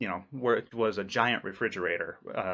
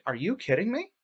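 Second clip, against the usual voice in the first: incredulous; 9 semitones higher than usual. Retold or imitated. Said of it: "I'm just like".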